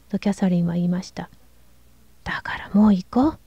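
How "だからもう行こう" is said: urgent whisper; eager whisper. A narrator's reading. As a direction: whisper it, pressing her to leave. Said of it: "熱心に小声で言いました". (熱心なささやき声)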